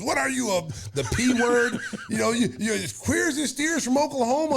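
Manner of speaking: high, laughing mock of a taunting coach; Johnny Bach taunt. Coach Johnny Bach taunting the rookie, mocking jeer. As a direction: lightly ham up the old coach's jeer. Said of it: "you know how Johnny Bach was".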